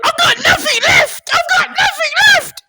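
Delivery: piercingly high hammed-up wail; Ian Beale. Impressionist accosting a lookalike, shrieking soap character impression. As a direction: shriek it in loud, squeaky despair. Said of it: "and I just went".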